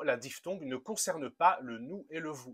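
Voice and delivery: ordinary talk, nothing marked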